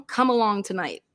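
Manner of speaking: normal talking voice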